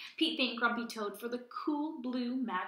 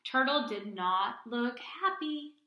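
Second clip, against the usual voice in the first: plain reading voice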